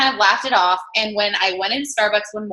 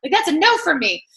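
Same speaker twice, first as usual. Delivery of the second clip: sassy, higher flat-out no; emphatic refusal; 9 semitones higher than usual. Retold or imitated. To a friend pitching business, a sassy emphatic no. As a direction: shut the pitch down hard and playful. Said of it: "And I was like".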